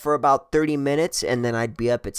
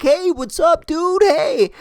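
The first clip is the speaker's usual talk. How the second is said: very high-pitched, hearty hail; squealed retold hello; excited greeting; 4 dB louder, slower than usual. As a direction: call out to a buddy across the street. Greets a buddy on the street.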